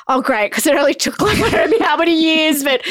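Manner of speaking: high, loud laughing retelling; rueful laugh, voice riding up high; laughing through tears. Real, not acted. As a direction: laugh it out, half crying, half amused. Retelling bursting into tears with daughter.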